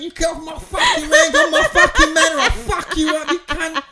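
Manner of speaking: very high, loud mock rage; screeching retold tirade; furious shouting. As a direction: yell threats as a hammed-up angry rival. Cousin's retold shouting at intruder.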